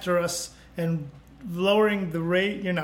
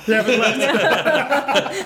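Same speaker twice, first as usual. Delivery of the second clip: laughing; 5 semitones higher, 5 dB louder, much slower than usual. Real, not acted.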